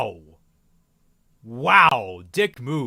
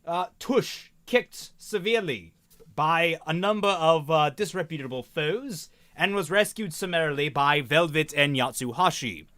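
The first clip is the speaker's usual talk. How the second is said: put-on posh accent. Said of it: "stop talking in this posh accent".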